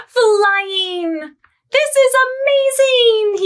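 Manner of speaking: high, delighted cry of wonder; thrilled. Acted. Bernard discovering he can fly, high thrilled cry. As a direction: squeal with joy at flying.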